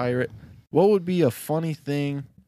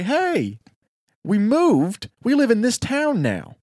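higher, brighter, louder motherly greeting; cheerful mom; 10 semitones higher, 4 dB louder, slower than usual. Acted. Storyteller voicing the mom greeting her returning son, high and cheery. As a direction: call out to your son with happy news.

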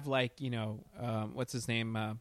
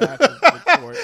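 high, loud, cracking mock squeal; mock squeaky report; 14 semitones higher, 14 dB louder than usual. Mocking Batman's exit line in a high laughing squeal.

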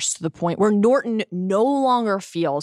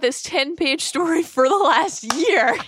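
mock baffled writer; 7 semitones higher than usual. Mocking the author's confession.

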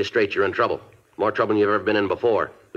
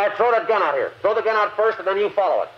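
shouted orders; 12 semitones higher than usual. Acted. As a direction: bark it across the hall. Ordering suspect out.

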